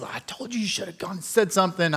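soft I-told-you-so; mock scolding wife. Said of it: "and Christina's".

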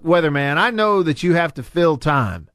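ordinary delivery

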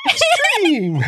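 high, drawn-out title yell; hyped announcer voice. Pretend. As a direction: shout it like an arena hype man.

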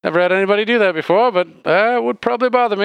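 high-pitched bartender, close to laughing. Acted. Bartender answers about his name, high voice breaking into laughter. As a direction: play a high-voiced barkeep cracking up.

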